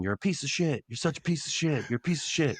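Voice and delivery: high-pitched mock yell of insults; imitating an angry player yelling from the box; retold yelling. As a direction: repeat the insult like a jeering chant. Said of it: "just kept yelling".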